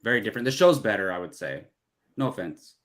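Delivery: ordinary talk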